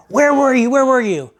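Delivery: high, loud, accusing "Where were you?" at her husband; retold scream. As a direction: yell it like an angry wife catching him late. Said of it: "My wife starts screaming at me".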